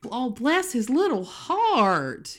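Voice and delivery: pitying coo. Shown as a demonstration. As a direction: dote over the poor little thing. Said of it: "for emphasis".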